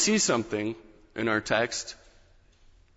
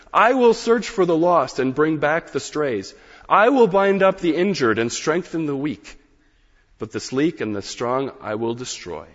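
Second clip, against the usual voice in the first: scripture reading; 4 dB louder than usual. Acted. Preacher reads God's promise to the congregation, proclaiming. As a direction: read the verses like God declaring.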